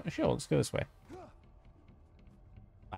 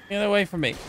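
high-pitched alarm; 8 semitones higher, faster than usual. Real, not acted. Player to attacking game enemies, alarmed. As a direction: fend them off in a higher voice.

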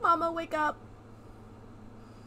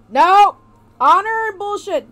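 loud, high, real outburst at the screen; yelling in protest, dragging it out; outraged shout; 6 semitones higher, 13 dB louder, slower than usual. Real, not acted. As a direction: shout it, slow and furious on the second. Outraged at game scene.

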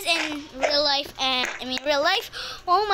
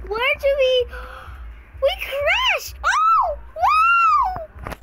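real shocked yell, much higher and louder; 12 semitones higher, 6 dB louder, much slower than usual. Real, not acted. Kid yelling at the crash in a Roblox game, real shock. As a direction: scream as the plane crashes.

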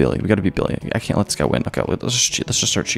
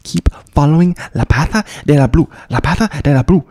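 mock Spanish; 4 semitones higher, 4 dB louder than usual. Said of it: "I don't know Spanish".

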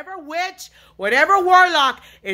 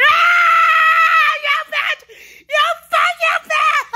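high scream breaking into a sob-laugh; screaming, close to tears; much slower than usual. Real, not acted. Tormented by the voices, screaming and sobbing. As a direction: scream it, half laughing, half crying. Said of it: "This yelling and screaming".

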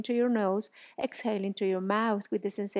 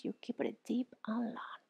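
whispered; 7 dB quieter than usual. Real, not acted. Reminder during class.

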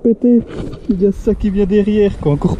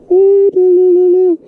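high giddy laugh; excited laugh; 10 semitones higher than usual. Real, not acted. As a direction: laugh with shaky joy. Laughing, shaking after catch.

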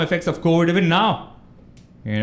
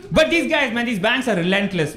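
normal talking voice

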